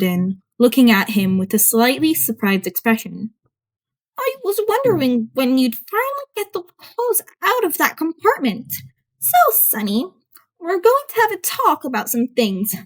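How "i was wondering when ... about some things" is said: put-on higher, knowing old lady voice; a dry old nurse catching him out. A narrator's reading. Elderly nurse confronts the boy.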